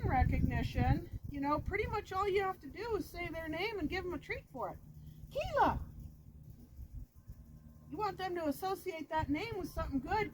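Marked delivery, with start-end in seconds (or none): 5.3-5.8 calling the dog. Shown as a demonstration. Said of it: "I'm gonna say it in a few different tones".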